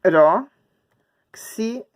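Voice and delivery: "Pa-ro-xi-" very slow, with long gaps between syllables. Shown as a demonstration. Teacher writing for pupils, "Pa-ro-xi-" broken into slow syllables. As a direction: spell out "Pa-ro-xi-" one loud beat at a time.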